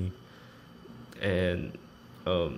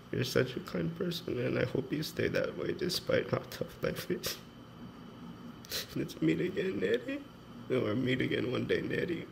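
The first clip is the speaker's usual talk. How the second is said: soft, higher, breaking voice; teary reading; 5 semitones higher, 6 dB quieter than usual. Real, not acted. Reading a farewell letter, softly crying. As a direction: let the voice crack as you read. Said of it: "It's like the seventh time I'm crying".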